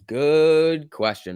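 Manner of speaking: "Good question" stretched very slowly; drawn out. Shown as a demonstration. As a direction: drawl "Good question" out, musing.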